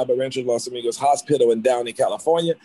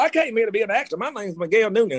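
lightly voiced eager country boy, pitched higher; a young newcomer's twang, high and eager; 8 semitones higher than usual. A young man tells a stranger his dream, in a country-boy voice. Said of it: "And I talked like this".

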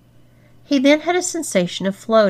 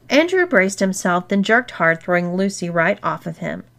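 ordinary delivery, nothing marked

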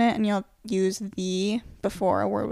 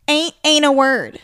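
louder, slow, emphatic teacher's correction; correcting teacher; 3 semitones higher, 6 dB louder, slower than usual. Retold or imitated. Teacher correcting a kid in class, slow and emphatic. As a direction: lay it down slowly like a teacher. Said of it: "then she'd always say".

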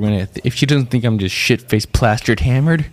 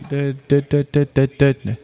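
ordinary talk

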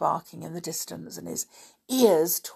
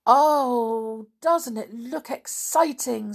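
high, louder thrilled exclaim; excited; 6 semitones higher, 5 dB louder, slower than usual. Acted. George watching the caravans, excited. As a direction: gush with excitement.

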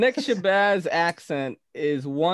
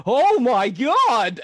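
slow, drawn-out and louder, a playful imitation; Nick Shabazz impression; 6 semitones higher, 5 dB louder, much slower than usual. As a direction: stretch it out slowly, hamming up the host's voice. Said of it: "and I went like this".

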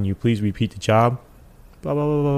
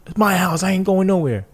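stubborn resident; 9 semitones higher than usual. Retold or imitated. Retold stubborn resident refusing.

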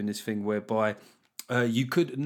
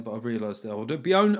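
normal talking voice

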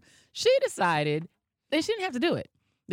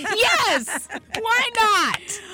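excited squeal; 9 dB louder, much slower than usual. Real, not acted. Playful high exclaim.

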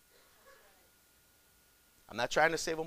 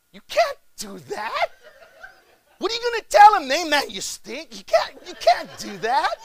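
high, laughing disbelief, rising on can't; incredulous laughing protest; 12 semitones higher, 9 dB louder than usual. Retold or imitated. Retelling his shocked reaction.

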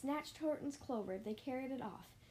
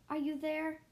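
loud, higher-pitched searching call; 5 semitones higher, 6 dB louder than usual. A narrator's reading. Horton searches clovers for his friends, raised call. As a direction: call out to someone hidden. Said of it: "and called".

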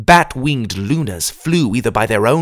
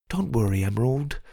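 tender; 7 dB quieter than usual. Acted. Brother comforting little sister.

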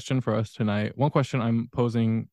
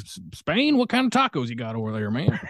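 clueless American voice; 3 semitones higher than usual. Retold or imitated. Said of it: "most Americans would be like".

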